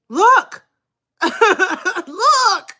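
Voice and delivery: high, laughing through the word; can't stop laughing while pointing. Real, not acted. Streamer showing chat Tiffany's name, laughing high.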